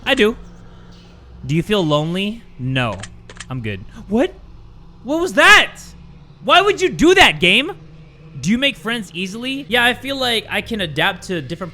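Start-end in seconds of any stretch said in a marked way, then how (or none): 4.1-7.8 high, loud startled what-was-that; startled outburst. Real, not acted. Startled by a sound in the game, yelling at it. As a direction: jump at the sudden noise and call it out.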